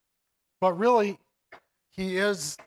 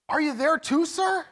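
put-on higher, eager question of a boy; light eager lift in the voice, as if thrilled; excited; 8 semitones higher than usual. A narrator's reading. Edmund eagerly asks Aslan, mildly excited. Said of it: "Edmund gets all excited".

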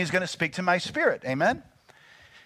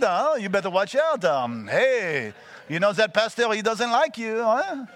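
hammed-up French devil, pitched up; French accent; 5 semitones higher, 3 dB louder, slower than usual. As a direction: taunt slyly in a comic French accent. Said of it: "devil had a little French accent".

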